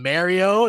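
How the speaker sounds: "Mario" with the first vowel as in "air"; imitated mispronunciation. Recalling podcasters who annoyed him, imitated mispronunciation MARE-ee-oh for "Mario". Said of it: "That used to annoy me".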